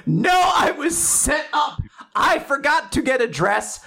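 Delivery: high, acted shout of protest; mock protest. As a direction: plead innocence in a hammed-up high yell. Latla protesting her innocence, acted.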